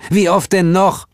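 high-pitched, exasperated question; fed-up high voice; droning impatience. A narrator's reading. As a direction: whine it as if asked too often. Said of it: "leierte Heel".

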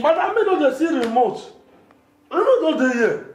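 high-pitched, pleading denial; protesting. Acted. Houseboy denying he sees the remote, high protest.

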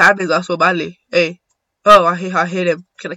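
normal talking voice

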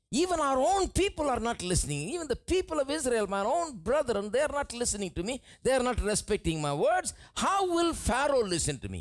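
high, fast anxious pleading; pleading Moses. Acted. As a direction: Moses begging God to send someone else. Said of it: "Moses goes before God and says".

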